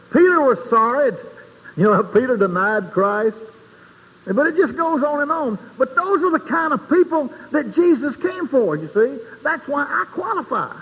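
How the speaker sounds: high, fervent preaching climb. Acted. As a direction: lift it up like a revival call.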